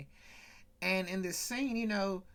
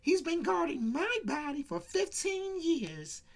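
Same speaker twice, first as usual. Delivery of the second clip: much higher, teasing woman's voice, lightly imitated; sly Geraldine; 9 semitones higher than usual. Geraldine pitching Killer to Jim Brown, high mock voice. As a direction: deliver it with a knowing wink. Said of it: "Geraldine was like".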